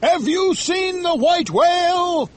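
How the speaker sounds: high, slow captain's hail; drawn-out, urgent cry in character; calling out. A narrator's reading. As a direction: call across to another ship. Ahab hails another whaling ship.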